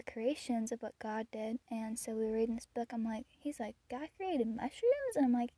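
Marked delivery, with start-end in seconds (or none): 3.9-5.2 put-on higher, slow, wondering child; little boy voice. Boy reading about creation, high and amazed. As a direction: ask it like an amazed toddler.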